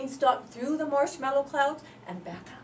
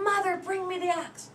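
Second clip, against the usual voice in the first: yelling; 8 semitones higher, 4 dB louder than usual. Acted.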